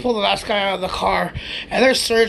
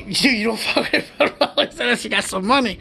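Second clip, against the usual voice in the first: ordinary talk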